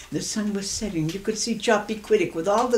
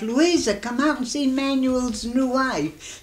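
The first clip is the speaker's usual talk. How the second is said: raised retold holler, higher and slower; 6 semitones higher, slower than usual. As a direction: call her out of the house. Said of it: "he hollers to his wife".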